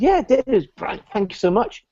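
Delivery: high, mock-delighted thanks; gushing thanks. Speaker recalls thanking the salesman, mock-gushing and high. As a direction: gush like a starstruck fan.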